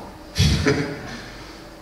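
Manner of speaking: short breathy laugh; brief laugh after coaxing the audience; awkward chuckle. Real, not acted. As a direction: chuckle at the silent room. No questions from the audience.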